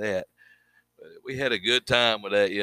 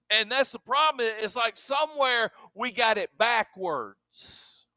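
preaching; 9 semitones higher than usual. Acted. Pastor urging his congregation, preaching full force. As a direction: drive the message home from the pulpit.

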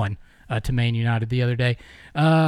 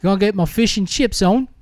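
mock London accent; 7 semitones higher than usual. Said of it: "I have no idea where it sounds".

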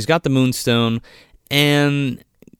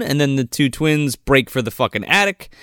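ordinary delivery

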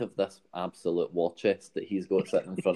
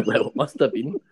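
laughing; 6 semitones higher, 6 dB louder than usual. Real, not acted.

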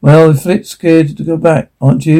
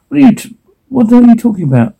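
panicked; 9 semitones higher, faster than usual. Acted. Larry reacting to sudden memory.

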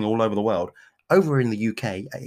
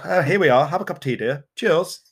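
plummy tea-party voice; posh British accent; 3 semitones higher than usual. Pretend. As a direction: play the English toff. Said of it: "the Americans love it when we do that voice".

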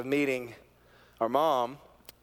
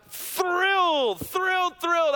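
high, loud repeated cries; thrilled; 6 dB louder, slower than usual. Acted. Preacher describing mother's joy, high excited repeats. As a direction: build the joy with each repeat. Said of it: "repeats word with joy".

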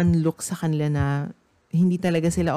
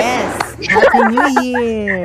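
high, loud, slow drawn-out cheer; 7 semitones higher, 7 dB louder, slower than usual. Pretend. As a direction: shout the greeting along with the barking dogs. Dogs bark again, cheering along.

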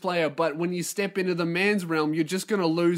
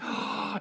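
sharp, voiceless intake of breath; delighted mock gasp; 9 dB quieter than usual. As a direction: gasp as if swept off your feet. Girlfriend reacts with delight.

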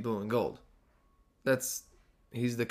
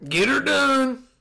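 high, loud hammed-up Southern whoop; 11 semitones higher, 5 dB louder, slower than usual. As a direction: belt out a mock country catchphrase in Southern accent. Mocks coach's Southern character act.

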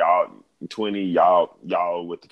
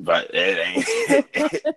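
laughing; 5 dB louder, much slower than usual. Real, not acted. Laughing at himself.

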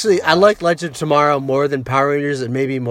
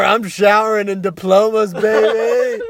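silly high boast; 12 semitones higher, 4 dB louder, much slower than usual. Pretend. Boasting mock character.

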